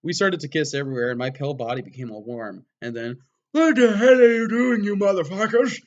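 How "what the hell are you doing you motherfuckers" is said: furious shout. Acted. Dumbledore bursts in shouting.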